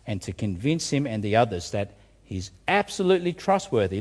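ordinary delivery

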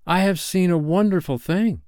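high, wondering cry; excited cry. A narrator's reading. Nibs to the gathering boys, excited high cry. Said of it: "he cried".

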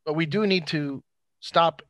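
normal talking voice

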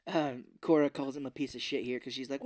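usual speaking voice

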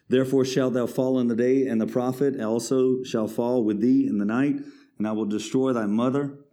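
loud, proclaiming verse; forceful scripture reading. Acted. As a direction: declare the judgement from the pulpit.

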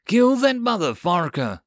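high-pitched battle yell; angry shouted order. A narrator's reading. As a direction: yell for the kill. Order yelled during the chase.

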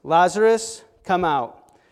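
commanding loud call; loud cry. Acted. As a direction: cry out to the dead man in the tomb. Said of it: "he cried out with a loud voice".

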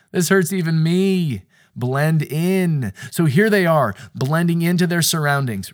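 fervent pulpit build; preaching at full force. Acted. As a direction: lift the room with passion.

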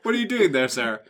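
loud, high mock challenge; suspicious questioner. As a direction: call out to the stranger, half-joking. Acted scene at the bleach hole.

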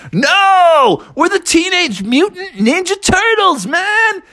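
high, exasperated dude yell; indignant shout. Acted. Turtles correct Froggy's wrong guess, indignant shouting. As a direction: stretch the first word, then proclaim the name.

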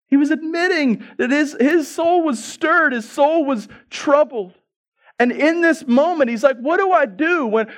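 high, emphatic preacher delivery; impassioned preaching. Acted. Preacher on God in the flesh being troubled, impassioned preaching. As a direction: preach it with astonished urgency, pitched high.

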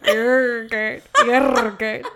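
Yergurt with a rolled, stretched R; drawn-out Yergurt. Shown as a demonstration. Mock-saying Yergurt to tease the podcast co-host, drawn out. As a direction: stretch out Yergurt, leaning on the R sound. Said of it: "ooh, do it".

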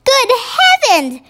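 high, sudden Good heavens; startled cry. A narrator's reading. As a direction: gasp it out in surprise. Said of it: "she cried".

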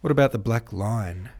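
loud, triumphant challenge; triumphant cry. A narrator's reading. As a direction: throw it out as the winning point. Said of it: "cried the American triumphantly".

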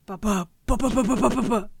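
rumbling ba-ba drumroll; rolling thunder noise. Pretend. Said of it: "How do you make Rolling Thunder sound?".

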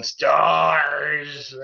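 lightly voiced silly monster cry; a hint of a goofy Nemesis yell; silly Raccoon City voice. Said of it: "his silly Raccoon City voice going".

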